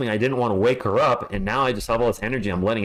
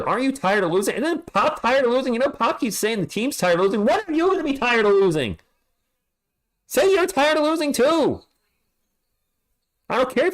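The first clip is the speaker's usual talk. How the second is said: high mocking impression of the coach, tired of losing; mock Pop voice; 11 semitones higher than usual. Streamer mocking coach Pop's tired of losing line, high mock voice. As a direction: mimic the coach nagging the team.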